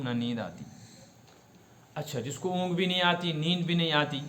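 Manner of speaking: ordinary talk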